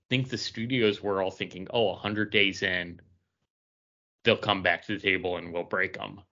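ordinary talk, nothing marked

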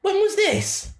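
high, incredulous squeak of a question; real astonishment. Real, not acted. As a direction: demand it in high-pitched disbelief. Listener astonished by the story.

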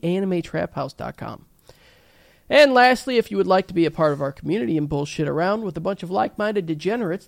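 brisk podcast plug read. Acted. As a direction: rattle off the sponsor plugs. Reads the show's plugs.

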